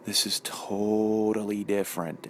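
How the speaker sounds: low, slow Wisconsin drawl imitating wife; Wisconsin accent. Wife reacting to the sandwich, slow Wisconsin accent. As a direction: stretch the vowels in "totally", Wisconsin style. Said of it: "she says it in her Wisconsin accent".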